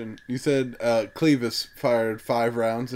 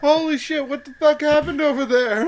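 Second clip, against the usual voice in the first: laughing; 11 semitones higher, 5 dB louder than usual. Real, not acted. Laughing reaction to gore.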